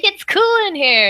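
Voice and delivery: high, loud exclamation; acted high-pitched outburst; mock freak-out. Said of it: "and she's freaking out".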